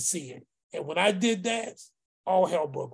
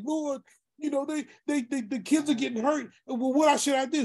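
higher-pitched, retold plea for guidance; pleading to God; 7 semitones higher than usual. Retelling a desperate prayer over hurting kids, higher-pitched plea. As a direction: cry out in prayer, voice raised in pitch. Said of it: "I'm crying out to God like".

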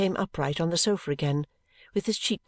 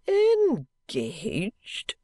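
shocked cry; 2 semitones higher than usual. A narrator's reading. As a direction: recoil as if struck by the news. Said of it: "cried Mister Turveydrop".

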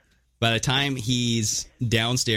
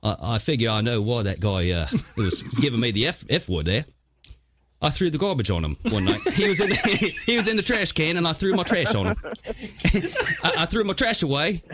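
put-on Australian accent; 6 semitones higher than usual. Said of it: "happens to be Australian".

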